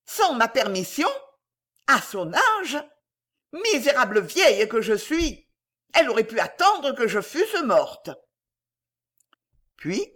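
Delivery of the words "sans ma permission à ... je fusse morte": higher-pitched indignant fragments; irritated. A narrator's reading. Old lady left fuming about her daughter, irritated. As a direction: fume in outraged bursts. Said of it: "fragments de phrases irritées".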